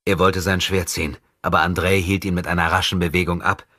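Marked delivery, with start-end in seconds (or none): none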